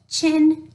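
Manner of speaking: chin stretched high and loud; sound demo. Shown as a demonstration. Teacher sounding out "chin" for kids, loud and high. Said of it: "now we have C H".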